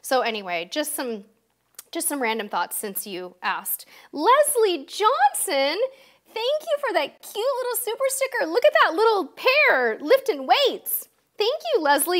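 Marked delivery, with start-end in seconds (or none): 4.1-12.2 gushing thanks. Real, not acted. Host coos her thanks to viewer Leslie, high and delighted. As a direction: coo over the cute little sticker.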